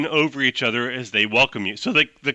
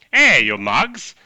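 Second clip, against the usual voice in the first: gangster mushroom; 2 semitones higher, 7 dB louder, slower than usual. Acted. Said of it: "another guy's like".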